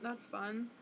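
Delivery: quiet, unenthused throwaway; soft, half-hearted approval; unconvinced "fun". Real, not acted. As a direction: say it like you don't really mean it. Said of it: "I didn't say that, like, like I really thought it was fun".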